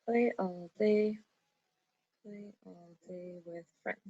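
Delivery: trailing off to a soft murmur as she writes; murmured while writing. Real, not acted. Teacher murmuring her line as she writes it down. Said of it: "I'm just writing this down".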